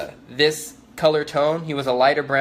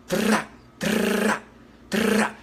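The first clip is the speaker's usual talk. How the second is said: higher, mouthed suppressed rifle bursts; imitated gunfire bursts; 7 semitones higher, much slower than usual. Describing shots fired inside the casino, imitated gunfire. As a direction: mimic three quick muffled shots. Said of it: "They were totally different".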